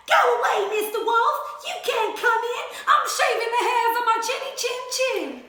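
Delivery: high, loud little-pig yell; pig yelling back. Acted. Pig yelling at the wolf through the door. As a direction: shout him off from behind the door. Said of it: "He yelled back".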